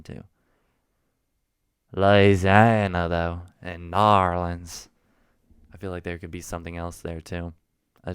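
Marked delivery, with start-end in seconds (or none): 1.9-4.9 loud, very slow Southern drawl; Southern accent. Shown as a demonstration. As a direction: stretch both names out loudly in Southern. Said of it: "this Southern accent is gonna be hard to shake".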